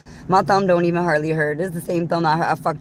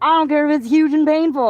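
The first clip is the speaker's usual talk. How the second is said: much higher, louder exclaiming; excited; 9 semitones higher, 4 dB louder than usual. Real, not acted. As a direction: blurt it out excitedly.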